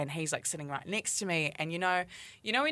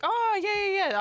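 high-pitched "oh" of recognition; acted recognition; 4 dB louder than usual. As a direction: react as if it just clicked. Acting out reply to a stranger.

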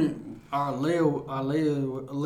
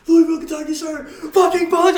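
high, loud barracks exchange; laughing drill sergeant bit; mock recruit then drill sergeant; 14 semitones higher, 9 dB louder than usual. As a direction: answer and get punished.

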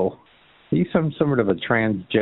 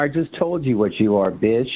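usual speaking voice

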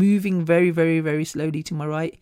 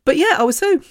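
tearful. Real, not acted. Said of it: "crying machine".

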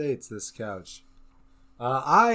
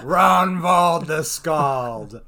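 grand announcer; 3 semitones higher, 10 dB louder, much slower than usual. Acted.

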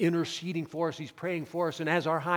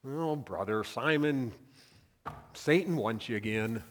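apathetic tone; 5 semitones lower than usual. Pretend. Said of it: "Or do you think it was apathy?".

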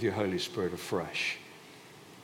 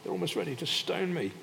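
exasperated plea, climbing much higher; Moses, crying out; 4 semitones higher than usual. Acted. Moses cries out to the Lord, exasperated plea. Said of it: "Moses cries out to the Lord".

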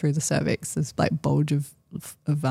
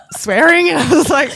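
laughing; 11 semitones higher, 7 dB louder than usual. Real, not acted. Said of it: "a bit of, like".

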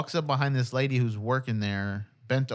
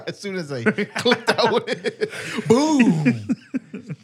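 laughing; 8 semitones higher, 5 dB louder, much slower than usual. Real, not acted. Laughing while telling the story.

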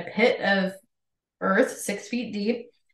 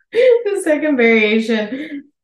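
laughing; 7 semitones higher, 7 dB louder, slower than usual. Real, not acted. Laughing while reading on.

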